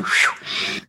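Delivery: quick breathy "whew"; whoosh of the mind. Retold or imitated. Telling how her mind just went, a whooshed "whew". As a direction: blow out a voiceless whew. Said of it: "my mind just went".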